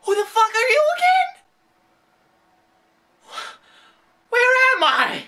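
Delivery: high, fast, loud befuddled blurt; high loud mock confusion; dazed drunk reply. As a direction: act bewildered and hostile. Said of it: "I'm gonna be like".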